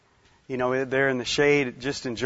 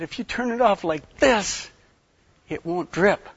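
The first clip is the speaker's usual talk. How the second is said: higher, with "this" punched hard; retold testy lesson, leaning on "this"; pointed demonstration; 5 semitones higher than usual. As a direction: drive home "this" like a forceful squeeze. Retold forceful demonstration to wife.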